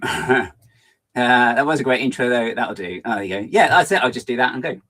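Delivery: ordinary delivery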